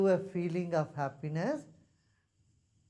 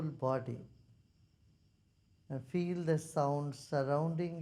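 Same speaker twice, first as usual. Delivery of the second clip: guided meditation; 2 semitones lower, 4 dB quieter, faster than usual. Real, not acted. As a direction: guide them to feel the sound, unhurried. Leads a meditation.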